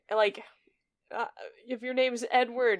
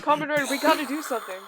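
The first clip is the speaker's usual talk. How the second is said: fast, high, loud panic; panicked urgent; 7 semitones higher, 7 dB louder, much faster than usual. Retold or imitated. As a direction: rush it out in panic. Acting out panicked dialogue.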